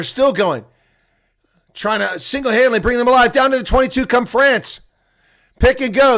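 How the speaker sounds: breathless live rugby call; excited play-by-play. Real, not acted. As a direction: call the break as it happens. Live play-by-play on a French attack.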